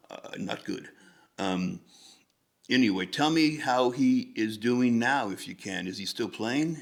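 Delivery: ordinary delivery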